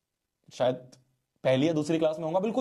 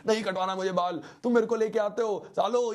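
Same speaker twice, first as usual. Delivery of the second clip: higher, imitating a furious little boy; half-hearted tantrum, pitched up; mock angry child yelling; 6 semitones higher than usual. Little boy cursing his elders in the street, mock tantrum. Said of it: "तेज-तेज चिल्ला रहा".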